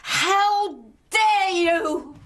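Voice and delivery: slow, furious outrage; outraged. Acted. Daughter outraged by cruel remark.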